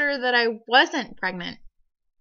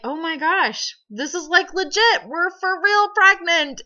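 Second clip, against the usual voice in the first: high, emphatic excitement; retold giddy disbelief, pitched up; amazed realization; 7 semitones higher, 4 dB louder than usual. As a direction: relive the amazement, voice climbing and getting louder. Retelling reaction to second positive test.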